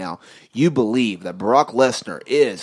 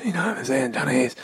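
Johnny Ace impression; 4 dB quieter, faster than usual. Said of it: "my Johnny Ace is pretty good too".

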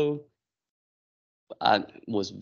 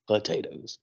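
passive aggressive; 4 dB quieter than usual. Retold or imitated. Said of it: "He said".

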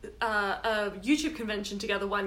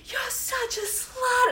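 mock shout; slower than usual. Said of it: "she starts shouting at me".

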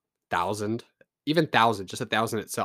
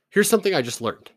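loud opener; 8 semitones higher, 8 dB louder than usual. Real, not acted. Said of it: "All right, something I just learned".

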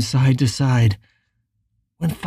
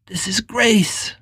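whispering; 4 semitones higher, slower than usual. A narrator's reading. Prayerful whispered inner thought.

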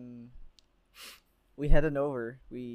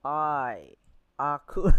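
epic fantasy narrator; 9 dB louder, much slower than usual. Pretend. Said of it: "Good reference".

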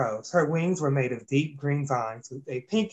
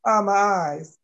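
higher, louder cry of pain; pained yell; 6 semitones higher, 4 dB louder, slower than usual. A narrator's reading. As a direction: yell as if blinded. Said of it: "the villains all yelled".

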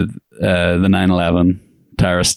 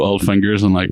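normal talking voice

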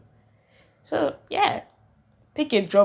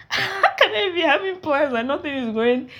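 laughing; 9 semitones higher, 6 dB louder than usual. Real, not acted. Laughing at own frustration.

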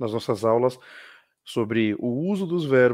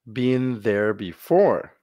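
slow teacher demo; 4 dB louder, much slower than usual. Shown as a demonstration. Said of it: "lesse comigo".

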